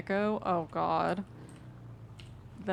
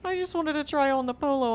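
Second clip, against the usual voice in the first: insistent; 8 semitones higher, 10 dB louder, faster than usual. Real, not acted. Repeats it louder, emphatic.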